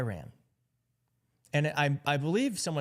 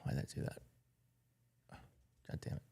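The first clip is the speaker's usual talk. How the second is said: whisper; 14 dB quieter, much faster than usual. Real, not acted. Muttering to herself after a misclick.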